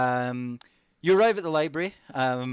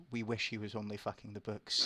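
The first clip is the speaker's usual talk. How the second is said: confiding; 4 semitones lower, 10 dB quieter than usual. Acted. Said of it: "he leans in close and says".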